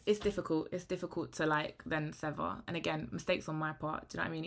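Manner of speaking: normal talking voice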